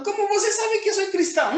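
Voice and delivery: high, fast, surprised asking; surprised question. Retold or imitated. Speaker retelling a coworker's puzzled question, high and fast. Retold dialogue, asking why.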